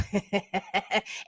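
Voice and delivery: a soft, breathy burst of laughter; quiet real laugh; breathless laugh. Real, not acted. Laughs while greeting a viewer.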